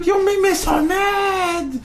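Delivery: high, slow mock fury; mock angry. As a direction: wail it in fake exasperation. Mock outburst at co-hosts.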